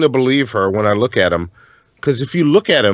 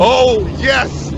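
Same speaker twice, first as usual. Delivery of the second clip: high-pitched delighted exclamation; excited cheer; 9 semitones higher than usual. Real, not acted. Viewer greeting the drunk people fall compilation, excited. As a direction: pitch it way up, thrilled.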